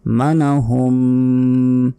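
মানাহুম with the final m hummed long; slow chant into a nasal hold; ghunnah. Shown as a demonstration. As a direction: sustain the end of মানাহুম through the nose. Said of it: "হুম এখানে গুন্না".

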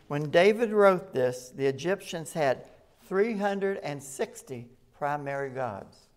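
ordinary delivery

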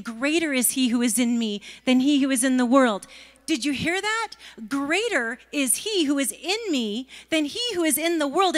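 preacher calling out the verse; proclaiming. Acted. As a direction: ring it out with conviction. Proclaiming scripture with rising force.

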